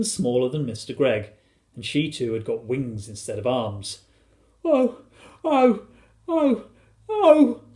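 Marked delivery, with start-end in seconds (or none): 4.6-7.6 high, wailing acted sobs; high-pitched storybook weeping; sobbing. A narrator's reading. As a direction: wail each "oh" separately. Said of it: "sobbed Missus Gregg".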